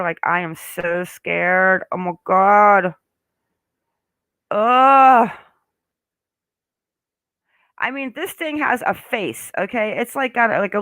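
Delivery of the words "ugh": loud high disgusted ugh; annoyed ugh. Real, not acted. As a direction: groan it out in revulsion. Disgusted by spider photo.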